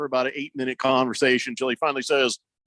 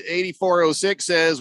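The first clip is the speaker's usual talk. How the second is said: ordinary talk, nothing marked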